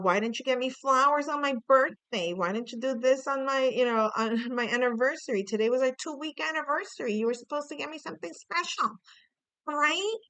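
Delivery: put-on high, rapid whiny nagging of a let-down girlfriend; mock complaint, climbing in pitch as it goes; complaining girlfriend. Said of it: "complaining about".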